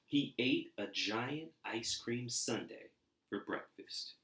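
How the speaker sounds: lively storybook reading; animated read-aloud for kids; story narration. A narrator's reading. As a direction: read it like a bedtime picture book. Reading picture book aloud.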